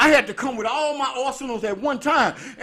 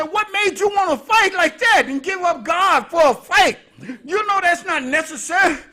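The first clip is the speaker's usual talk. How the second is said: loud, raised scolding auntie; 8 semitones higher, 6 dB louder than usual. Retold or imitated. Auntie scolding nephew for fighting, raised and loud. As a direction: scold him for giving up God. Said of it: "She said".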